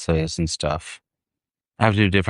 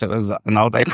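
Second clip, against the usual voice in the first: usual speaking voice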